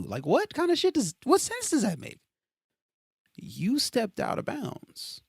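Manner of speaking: loud, high, incredulous rant; exasperated. Real, not acted. Ranting at someone who stepped out of bounds, loud and high. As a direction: pitch it way up in disbelief.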